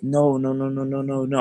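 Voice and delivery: rapid panicked denials, lightly imitated; Kevin Hart. Backing off from the angry girl, lightly like Kevin Hart. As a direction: stammer out repeated noes in mock alarm. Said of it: "I sound like Kevin Hart".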